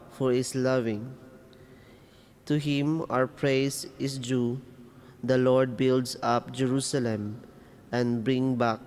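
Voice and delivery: normal talking voice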